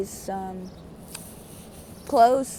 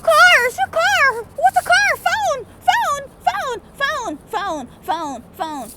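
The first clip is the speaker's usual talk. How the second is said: very high, drawn-out squeals of wonder; high acted awe, easing down by the end; amazed; 5 dB louder, much slower than usual. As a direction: marvel like a time traveller from cave days. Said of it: "everything was amazing to me again".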